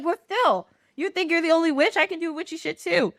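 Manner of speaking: high, quick mock-villain boast; cackling witch. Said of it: "Frida's cackling".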